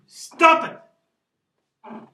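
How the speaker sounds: very loud, high-pitched outburst; sudden shout. Acted. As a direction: yell it abruptly, breaking out of the recital. Sudden outburst inside the poem.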